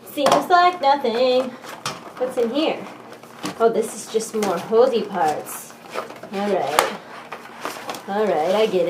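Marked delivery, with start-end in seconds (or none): none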